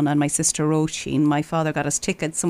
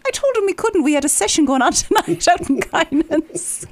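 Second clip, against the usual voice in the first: high, laughing mock of the father; lightly voiced proud dad, laughing; unbothered father; 11 semitones higher, 3 dB louder than usual. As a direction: play him as cheerfully unbothered. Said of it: "He said".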